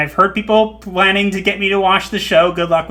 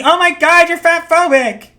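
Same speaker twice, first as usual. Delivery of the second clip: high, loud mock-offended cry; mock outrage; 10 semitones higher, 5 dB louder than usual. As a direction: imitate a scandalized critic. Mimics outraged online critics.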